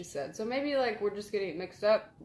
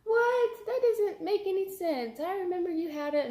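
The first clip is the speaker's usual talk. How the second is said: much higher, shocked mother's voice; incredulous mom; 11 semitones higher than usual. Retold or imitated. Mom in disbelief on hearing the movie never existed, high shocked voice. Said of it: "And she was like".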